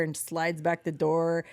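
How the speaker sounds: normal talking voice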